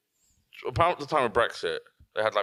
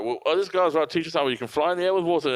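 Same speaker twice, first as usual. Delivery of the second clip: higher-pitched, imitated presenter pitch; mock-enthusiastic demonstration intro; eager presenter; 8 semitones higher than usual. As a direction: play a showman setting up a stunt. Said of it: "When he's on the dock".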